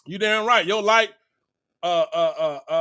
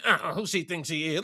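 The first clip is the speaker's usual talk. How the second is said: mock jealous gossip, lower and softer, sneering; catty hater girl; 5 semitones lower, 6 dB quieter than usual. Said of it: "they say".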